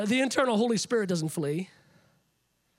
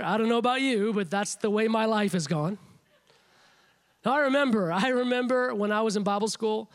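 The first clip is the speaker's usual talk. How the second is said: ordinary delivery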